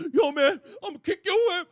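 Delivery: loud, fast tough-guy threat; mock angry threat. Host imitating an angry fighter, loud and fast. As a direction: hype up the street threat. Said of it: "that kind of anger".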